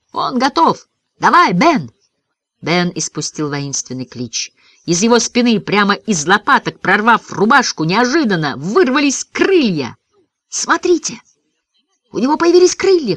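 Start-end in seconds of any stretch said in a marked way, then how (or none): 0.1-1.9 high, eager cheer; called out. A narrator's reading. Boy cheering Ben on to take off, eager call. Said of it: "крикнул я". 10.5-13.2 high, excited shout; amazed cry. A narrator's reading. Boy pointing out Ben's new wings, amazed high cry. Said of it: "крикнул я".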